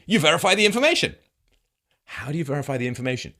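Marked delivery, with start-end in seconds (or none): 0.1-1.2 high, loud, fast mock of a glib answer; mocking Scott. Mocking the guest's glib answer, high and fast. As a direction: toss it off as if it were obvious. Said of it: "Scott's answer is not even a tautology".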